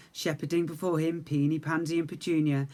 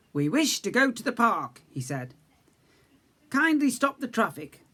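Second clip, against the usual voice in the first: higher, louder formal request; polite hedgehog request; 6 semitones higher, 5 dB louder than usual. Acted. Max asks the lollipop lady to stop traffic, higher. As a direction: ask grandly and politely.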